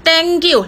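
"thank you" high as "teng-kiu"; child's mistaken "thank you". Retold or imitated. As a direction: imitate pupils saying "thank you" with t. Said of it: "สอนเด็กน้อยให้ออกเสียงว่า thank you". ("thank you" เลียนเด็ก เต๊งกิ้ว เสียงสูง)

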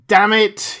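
sudden bellow, cut short; bellowing start. A narrator's reading. Furious man caught at gunpoint, a bellow. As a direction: burst out loud, then stop yourself. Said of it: "he started to bellow".

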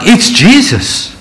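an eager Jesus inviting Peter; voicing Jesus. Jesus voiced in retelling.

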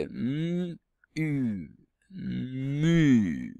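hummed M, long U, then a long MU; each sound stretched out, MU falling at the end. Shown as a demonstration. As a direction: blend "M" and "U" slowly into "mu". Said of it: "Donc ça fait".

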